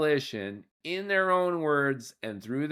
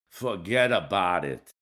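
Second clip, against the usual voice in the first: put-on lower-pitched New Jersey mobster accent, dismissive; Tony Soprano accent; 6 semitones lower than usual. Podcaster brushing off a two-state solution, in a mock New Jersey mobster accent. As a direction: toss it off like a Jersey mob boss in a New Jersey accent. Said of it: "Do a Tony Soprano's accent".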